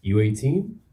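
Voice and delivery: lightly voiced president asking; a hint of the president; president impression. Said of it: "my impression of the president asking a woman on a date".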